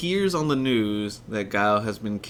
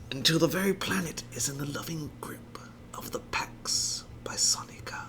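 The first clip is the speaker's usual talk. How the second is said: villain monologue; 4 semitones higher than usual. Retold or imitated. Performing Bison's world-domination speech, hushed and grandiose. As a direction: savor the grand plan in a whisper.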